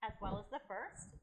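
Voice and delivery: ordinary delivery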